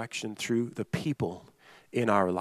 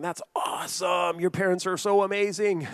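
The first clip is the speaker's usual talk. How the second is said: imitating awestruck teens, pitched higher; amazed; 5 semitones higher than usual. Students reacting to a friend with no curfew, amazed. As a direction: gush in mock amazement, drawing out the praise. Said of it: "their mouths were hanging open".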